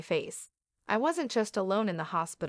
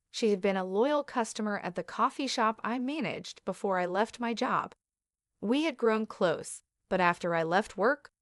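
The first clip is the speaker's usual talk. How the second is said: usual speaking voice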